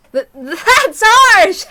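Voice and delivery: high, loud, slow laughing protest; teased photographer laughing painfully; pained laugh. Acted. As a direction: laugh it off, wounded. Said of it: "Tomitake was the only one with a pained grin".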